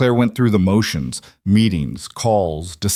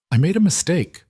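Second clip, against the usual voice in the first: higher, gentle measured admission; careful; 9 semitones higher than usual. A narrator's reading. Woman admits fault to the girl, carefully. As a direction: confess cautiously to a child. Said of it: "Claire said carefully".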